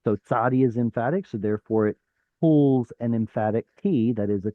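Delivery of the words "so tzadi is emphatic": ordinary talk, nothing marked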